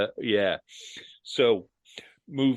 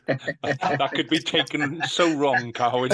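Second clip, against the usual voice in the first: higher, louder, slowed by laughter; amused, laughing; 6 semitones higher, 6 dB louder, slower than usual. Real, not acted. Teasing Carwyn about an accidental innuendo, laughing. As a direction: draw it out through laughter.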